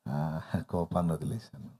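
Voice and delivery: soft, low and overly serene; hammed-up long-suffering calm; mock saintly patience. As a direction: play the man who has given up anger, all sweetness. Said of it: "దీర్ఘశాంతంగా ముఖం పెట్టి".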